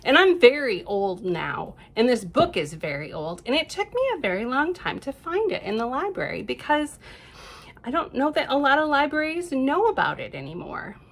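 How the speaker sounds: usual speaking voice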